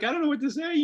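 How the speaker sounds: high, loud flustered stammer; stalling while searching for words; flustered parent. Retold or imitated. Parent caught off guard, flustered and high-pitched.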